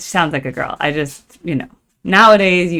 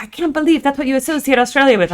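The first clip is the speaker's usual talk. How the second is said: high, quick mock outrage; imitating upset commenters; 9 semitones higher, faster than usual. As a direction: parrot the offended viewers, pitched up. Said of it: "People were upset".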